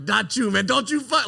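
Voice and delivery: a hint of Matt Damon's intense threat, laughing through it; half-laughing mock tough-guy warning to a friend; Matt Damon impression. Retelling a drunk bar fight, a lightly voiced Matt Damon threat. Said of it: "like Matt Damon in Good Will Hunting".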